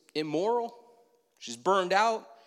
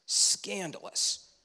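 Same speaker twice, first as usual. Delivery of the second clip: hushed emphasis; 2 semitones higher, much slower than usual. Real, not acted. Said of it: "repeats the word for emphasis".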